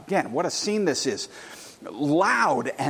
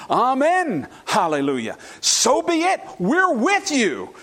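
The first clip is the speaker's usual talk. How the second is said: loud worship cry; 7 semitones higher, 4 dB louder than usual. Acted. Preacher voicing elders' worship cry.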